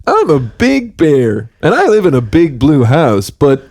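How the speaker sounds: put-on cheery kids' show bear voice; friendly bear host. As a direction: welcome the kids into the big blue house. Bear sketch continues.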